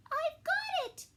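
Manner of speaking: sudden high burst of an idea; excited cry. Acted. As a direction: snail realising how to save the whale. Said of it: "she cried".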